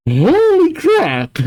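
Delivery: high, slow, drawn-out exclamation; stunned shock. Real, not acted. Reader shocked by the letter, stunned exclamation. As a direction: stretch it out in high-pitched disbelief.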